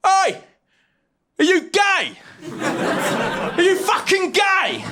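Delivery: high-pitched yelled heckle; heckling shout. Retold or imitated. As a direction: holler it across the street at a stranger. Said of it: "and just shout".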